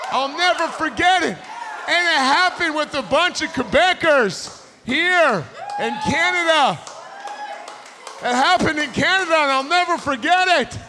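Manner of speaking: loud excited preacher's proclamation; emphatic shout. Real, not acted. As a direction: declare it to the whole room. Preacher building to emphatic declaration.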